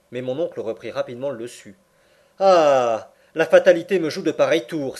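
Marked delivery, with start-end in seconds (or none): 2.4-5.0 loud, raised outburst against fate; crying out. A narrator's reading. Uncle railing at fate's tricks, crying out. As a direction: exclaim it loudly, pitch up. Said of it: "s'écria-t-il".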